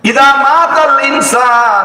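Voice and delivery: high, impassioned cry of the Arabic words; fervent preached hadith. Acted. Preacher crying the hadith out to the congregation, high fervent preaching. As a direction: call the hadith out to the crowd.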